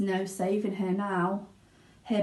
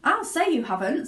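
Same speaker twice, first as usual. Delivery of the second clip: higher, loud snapping retort; Mrs Beauregard; 9 semitones higher, 5 dB louder than usual. Acted. Mother snaps at Wonka, indignant. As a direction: bite back at Wonka. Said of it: "said Missus Beauregard".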